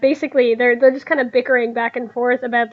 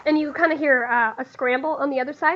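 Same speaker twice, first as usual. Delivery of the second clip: normal talking voice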